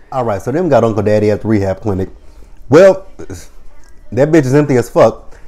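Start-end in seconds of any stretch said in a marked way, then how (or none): none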